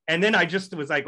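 ordinary delivery

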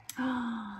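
soft theatrical gasp of wonder; a small storybook gasp; mock gasp. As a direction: gasp as if revealing a secret. Dramatic pause before the sky.

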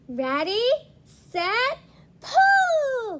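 rising to a high shouted pull; calling out. A narrator's reading. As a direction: count off and yell pull. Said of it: "called the bat".

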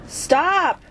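high, strained cry of stop; desperate yell. Real, not acted. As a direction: yell at them to quit. Creatures wrecking his Seamoth.

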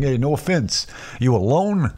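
cartoon Guido. Guido at the comedy club apologizing to neighbor, cartoonish. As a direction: imitate a very Italian dude apologizing. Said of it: "like, cartoon Guido type of guy".

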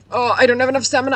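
fast, high panicked yelp; alarmed climbing shout; panicked cry. Real, not acted. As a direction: cry out as the stamina runs dry.

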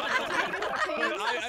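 laughing. Real, not acted. Laughing after the token mix-up.

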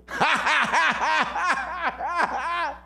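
high, mocking run of ha-ha-has; sarcastic laugh. Mourners laughing Jesus to scorn, hammed-up sarcastic laugh. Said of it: "they laughed sarcastically".

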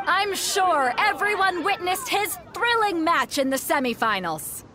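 high, slow, booming arena introduction; grand announcer. Acted. Announcer presenting the finalist to the roaring crowd. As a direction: build the hero up to a big reveal.

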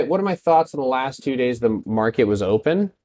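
ordinary delivery

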